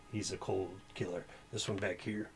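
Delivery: normal talking voice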